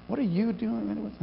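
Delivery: hushed bewildered question; baffled hushed. Shown as a demonstration. Party-crasher caught, soft baffled question. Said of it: "showed up to the party".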